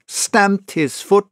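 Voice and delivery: plain reading voice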